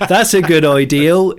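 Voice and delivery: loud laughing "That's a good ideal" with a Bristol ideal ending in L; mock Bristol accent, loud, through laughter; Bristolian good ideal. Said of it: "good ideal instead of good idea".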